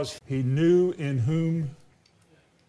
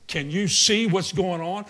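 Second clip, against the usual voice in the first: ordinary delivery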